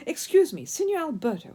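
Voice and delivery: announcing. A narrator's reading. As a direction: announce himself eagerly. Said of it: "he announced".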